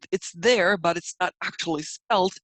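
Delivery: ordinary delivery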